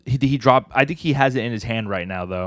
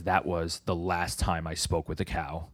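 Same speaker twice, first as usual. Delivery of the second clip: low, slow, subdued narrator; narrator voiceover; 4 semitones lower, 7 dB quieter, slower than usual. Retold or imitated. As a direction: read it as a soft, slow closing voiceover. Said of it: "like, a narrator thing".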